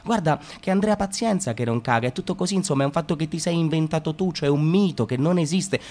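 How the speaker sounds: usual speaking voice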